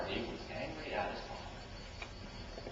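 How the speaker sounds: slow, whispered aside; whispered sermon line; hushed. Acted. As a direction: drop to a breathless whisper. Preacher drops to hush.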